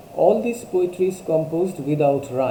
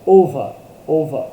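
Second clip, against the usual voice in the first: "Over" loud, with a strong punch on "ও"; two-syllable stress demo; 9 dB louder than usual. Shown as a demonstration. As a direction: hit the first syllable of "over" hard. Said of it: "দুটো যদি syllable থাকে".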